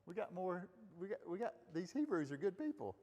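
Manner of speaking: a hint of a satisfied servant chatting; Pharaoh's court pleased. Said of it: "It's like".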